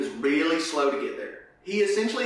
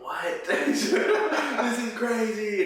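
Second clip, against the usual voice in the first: laughing; 10 semitones higher, much slower than usual. Retold or imitated. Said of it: "And Lazarus just like".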